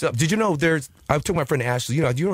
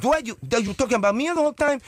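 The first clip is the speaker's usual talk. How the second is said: imitating the girl; 10 semitones higher than usual. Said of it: "then she goes".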